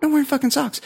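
high, fast exasperated protest; annoyed retort. Retold or imitated. Retelling his reply about the socks, annoyed. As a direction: rush it out high, fed up with denying it. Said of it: "I was just annoyed".

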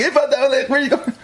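cheerful, laughing high question to a hitchhiker; friendly driver as he really said it. Retold or imitated. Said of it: "They didn't say it like that".

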